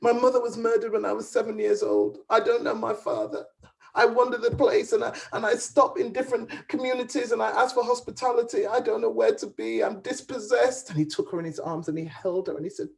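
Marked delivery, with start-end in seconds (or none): 0.0-10.9 tearful confession. Acted. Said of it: "great big fat tears began to roll".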